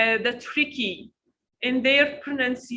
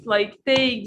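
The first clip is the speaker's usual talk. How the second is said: usual speaking voice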